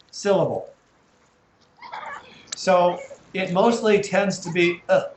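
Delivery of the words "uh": "uh" as a short unstressed vowel; schwa sound. Shown as a demonstration. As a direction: say a brief, neutral "uh". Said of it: "mostly pronounced, uh".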